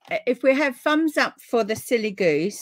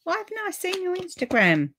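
much higher, puzzled why; surprised question; 11 semitones higher than usual. Real, not acted. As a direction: exclaim at her own oversight. Surprised at herself.

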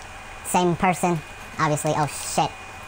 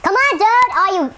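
high, loud exasperated shout; frustrated yell; 14 semitones higher, 6 dB louder than usual. Real, not acted. Gamer shouting at the game, frustrated yell. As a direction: shout at the screen.

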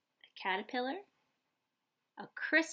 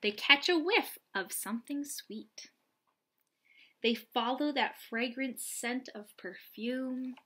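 bright, louder read-aloud storytelling voice; picture book reading; 3 semitones higher, 5 dB louder than usual. Acted. Reading a butterfly picture book to young children, lively and bright.

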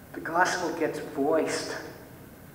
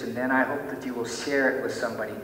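ordinary talk, nothing marked

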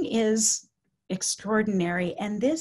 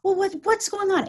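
high, urgent question; mirrored agitation; 10 semitones higher, 4 dB louder than usual. Retold or imitated. Caregiver meeting an agitated resident, urgently mirroring. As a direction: ask it at the upset person's intensity. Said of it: "I mirrored her anxiety and anger".

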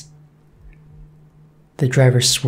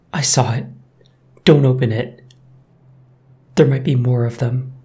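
trembling voice. A narrator's reading. Old woman warning the driver, lightly trembling. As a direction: warn them with mild fear. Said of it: "in a trembling voice".

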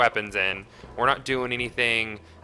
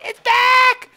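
much higher and louder, yelling in glee; triumphant shout; 11 dB louder than usual. Real, not acted. Player shouting that his lost thought returned. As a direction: burst out as the idea comes back.

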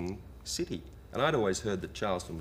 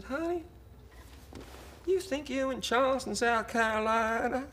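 put-on twangy Southern accent. Motel clerk questioning a lost tourist, twangy Southern accent. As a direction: mimic a Southern woman in a very high twang. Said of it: "her accent was so weird and twangy".

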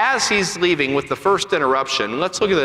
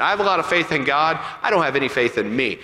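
normal talking voice

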